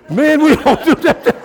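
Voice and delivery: high, laughing repeat; real laughing. Real, not acted. Preacher cracking up as he teases the men, laughing. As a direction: crack up while getting the words out.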